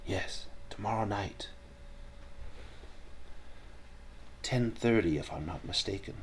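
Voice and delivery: hushed, half-whispered confirmation; quiet overheard reply. Acted. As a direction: confirm it softly, as if behind someone in a lecture. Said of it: "voices speaking quietly behind you".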